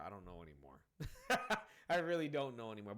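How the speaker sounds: amused, laughing at your own uncertainty; laughing admission. Real, not acted. Reviewer admitting he has lost track of the story, laughing. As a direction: break into a real laugh.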